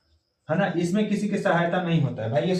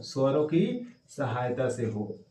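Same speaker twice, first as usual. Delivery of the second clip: स्वरों की सहायता से हो said slowly, phrase by phrase; slow dictation; 2 semitones lower, slower than usual. Shown as a demonstration. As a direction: spell out the definition slowly. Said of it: "किससे हो?". (परिभाषा धीरे-धीरे लिखवाना)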